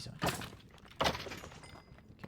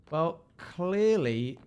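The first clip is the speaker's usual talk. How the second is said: drawn out; 6 semitones higher, 6 dB louder, much slower than usual. Real, not acted. Drawn-out mock pronouncement.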